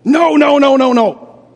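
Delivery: loud, insistent string of no; emphatic no. Real, not acted. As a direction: hammer the answer home with each no. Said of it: "emphatically no".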